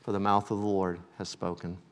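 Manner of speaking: ordinary talk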